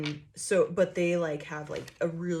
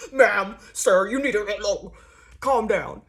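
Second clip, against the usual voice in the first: louder, stern cop-like voice; mock officer; 7 dB louder, slower than usual. Mimicking someone telling her to calm down, mock stern. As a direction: imitate a condescending officer. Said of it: "they're like".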